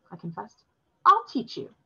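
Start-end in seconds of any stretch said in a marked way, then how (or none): 1.0-1.7 bright lofty offer, pitched high and loud. A narrator's reading. Robin offering to teach the boy, lofty and high. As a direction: talk down a little, proud to teach. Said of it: "Robin said loftily".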